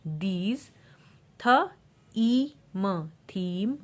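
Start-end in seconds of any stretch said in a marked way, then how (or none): 1.4-3.1 थ high and punched, then ई, म apart; sounding out. Shown as a demonstration. Teacher showing magic E, sounding out थ, ई, म. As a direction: separate थ, long ई, म. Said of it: "ई का long sound होगा".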